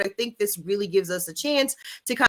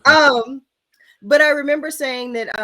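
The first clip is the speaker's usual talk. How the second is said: talking through hiccups; hiccuping between words; 6 semitones higher, 9 dB louder, slower than usual. Real, not acted. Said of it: "I'm sorry, I have the hiccups".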